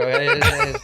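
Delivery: very high squeal of laughter; laughing. Real, not acted. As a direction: crack up mid-word. Breaks up laughing.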